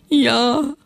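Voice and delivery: high, drawn-out mock wail; hammed-up wailing old potatoes. A narrator's reading. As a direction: howl it out mournfully. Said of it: "heulten die Altkartoffeln auf".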